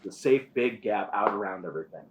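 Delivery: usual speaking voice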